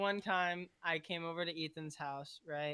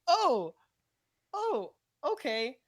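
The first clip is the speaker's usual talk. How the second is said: high, loud startled "oh"; re-enacted surprise, pitched up; startled realization; 7 semitones higher, 7 dB louder, slower than usual. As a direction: act out walking in on something awkward. Acting shocked at walking in.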